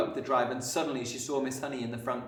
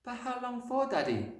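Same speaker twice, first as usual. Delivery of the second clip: put-on high little girl's voice; small, high-pitched worried question; plaintive cry; 13 semitones higher than usual. A narrator's reading. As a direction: plead anxiously with your father. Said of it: "Matilda cried".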